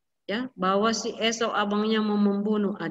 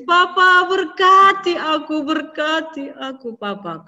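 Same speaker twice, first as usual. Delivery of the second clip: crying plea fading; 5 semitones higher, 6 dB louder than usual. A narrator's reading. Esau pleading with his father, crying then fading. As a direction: beg hard then run out of breath. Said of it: "Dia nangis".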